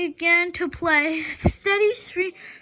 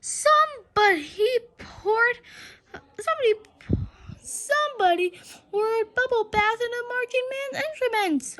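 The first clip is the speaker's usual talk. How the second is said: indignant fan; 7 semitones higher than usual. Acted. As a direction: call it out across the stands. Fan shouting accusation.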